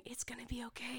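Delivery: hushed soothing whisper; mock tender whisper to a horse. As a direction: whisper it to calm a scared horse. Voicing Tommy soothing horse.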